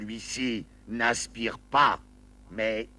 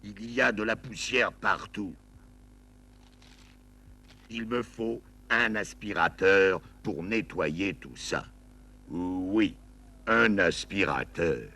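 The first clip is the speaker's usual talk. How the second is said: ordinary delivery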